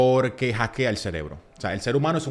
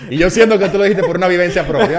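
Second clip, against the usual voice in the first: loud, higher, laughing through the teasing; 8 semitones higher, 12 dB louder than usual. Real, not acted. Coach laughs and teases the host about a personal experience, laughing loudly. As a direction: tease while cracking up.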